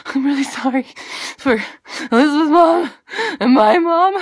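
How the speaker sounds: high, loud, gasping horror-spoof apology; hammed-up panicked sobbing into the camera; mock tearful apology. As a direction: apologize through fake terrified gasps. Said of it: "I got really close to the camera, and I did the".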